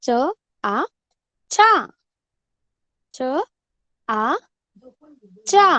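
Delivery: च, आ said apart, then चा; blending sounds aloud. Shown as a demonstration. Teacher drills class on चा, sounding out. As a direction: sound out च and आ, join into चा. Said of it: "च को आ की मात्रा लगाया जाए".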